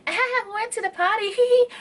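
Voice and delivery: bubbly mock girl with a giggle; hammed-up girl boasting about a party. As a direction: imitate a show-off post, giggling. Mocking girl's party post.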